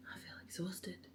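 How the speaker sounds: whispering so he can sleep; soft whisper, trying not to wake anyone; quiet at night. Real, not acted. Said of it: "if you're wondering why I'm whispering".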